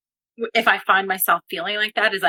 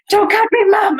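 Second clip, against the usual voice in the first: much higher, louder mock child cry; a scared little boy shouting at mom; child's protest; 12 semitones higher, 7 dB louder than usual. Son begging mom during his haircut, high yelled protest.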